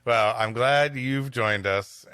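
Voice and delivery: ordinary talk, nothing marked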